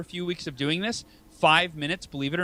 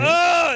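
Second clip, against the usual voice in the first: mock groan; 7 dB louder than usual. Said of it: "you're like".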